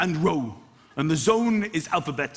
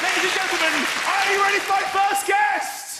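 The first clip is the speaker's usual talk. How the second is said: a big, much higher, louder announcer build-up; compere intro; 13 semitones higher, 3 dB louder than usual. Acted. A compere announcing Mickey Flanagan to the crowd in a big booming intro. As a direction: hype the crowd and bring on the act.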